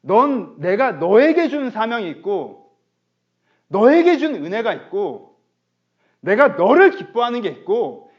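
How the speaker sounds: loud, slow, urgent sermon delivery; full-force preaching. Acted. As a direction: drive each phrase home to the congregation.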